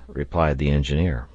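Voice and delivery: ordinary delivery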